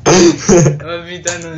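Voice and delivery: loud exasperated groan. Real, not acted. Flubbed intro, bursts out.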